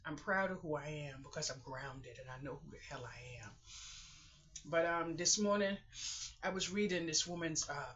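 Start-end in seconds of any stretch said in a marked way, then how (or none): 0.1-3.5 whisper. Real, not acted. Whispered, unvoiced.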